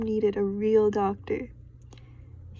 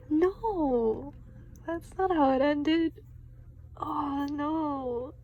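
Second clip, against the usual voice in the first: surprised; 5 semitones higher, slower than usual. Real, not acted. Reader reacting to the ending.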